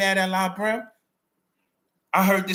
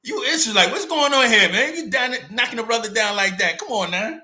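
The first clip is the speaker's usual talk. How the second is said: indignant call-out; 7 semitones higher, 4 dB louder than usual. Retold or imitated. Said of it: "I was like".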